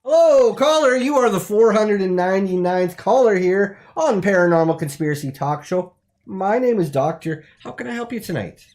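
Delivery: high, loud cheery host voice; radio host greeting. Acted. Paranormal talk show host greets the caller, cheery and loud. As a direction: beam it at the caller.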